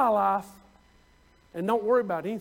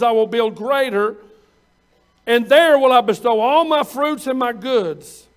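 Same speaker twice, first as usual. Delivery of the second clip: preaching scripture; 6 dB louder than usual. Acted. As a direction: proclaim the parable to the congregation. Preacher reading scripture with force.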